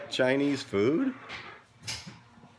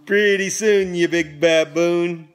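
loud playful rhyme; 6 semitones higher, 7 dB louder than usual. Acted.

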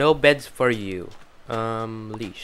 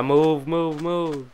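normal talking voice

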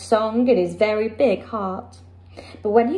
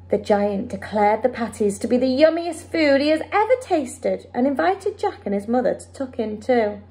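usual speaking voice

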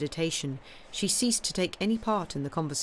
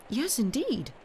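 higher quick exclamation; exclaiming; 7 semitones higher, slower than usual. A narrator's reading. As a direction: agree with a burst. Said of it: "Eugénie exclaimed".

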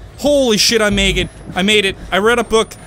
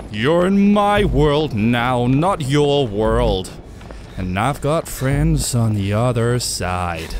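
slow, low, drawn-out menace; mock villain taunt; 10 semitones lower, much slower than usual. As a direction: stretch it out like a gloating villain. Sings a villain's taunt in game.

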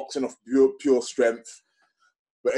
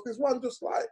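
pitched way up as the mate; squeaky-high imitation of his mate; a mate's high quoted pitch; high mock friend voice; 10 semitones higher than usual. Friend's quoted advice retold.

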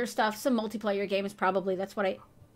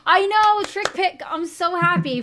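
high, loud and gleeful; excited; 7 semitones higher, 7 dB louder than usual. Real, not acted. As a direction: burst out happily. Thrilled teammate finally joined.

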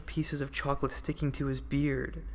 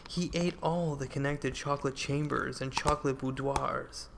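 plain reading voice

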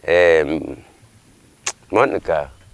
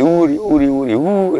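baboon's big call; 8 semitones higher than usual. Retold or imitated. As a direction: whoop out a baboon's holler from the treetops. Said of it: "is a big voice".

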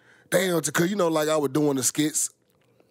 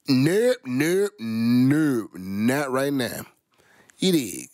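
flat refusal; much slower than usual. Real, not acted. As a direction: shut it down, no way. Refusing to do it right now.

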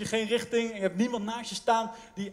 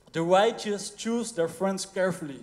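scripture reading; 3 semitones lower than usual. Real, not acted. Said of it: "Spreuken twaalf vers zesentwintig staat".

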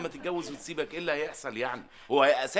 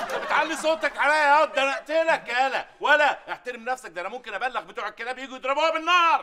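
much higher, much louder furious yelling; furious shout; 10 semitones higher, 6 dB louder than usual. Acted. Boss yelling at servant who raised his voice, furious. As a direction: blow up at him, threatening.

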